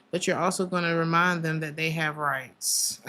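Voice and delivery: ordinary talk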